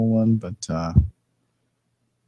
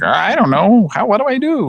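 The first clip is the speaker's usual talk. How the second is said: confused janitor; 10 semitones higher, 4 dB louder than usual. Retold or imitated.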